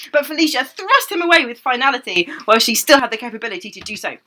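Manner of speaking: ordinary talk, nothing marked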